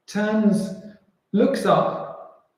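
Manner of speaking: normal talking voice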